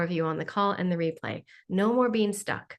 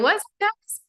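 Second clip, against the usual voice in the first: mock-annoyed at two in the morning; joking complaint to guides; 6 semitones higher than usual. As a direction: tease them like sleepy friends. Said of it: "I'm super funny with them".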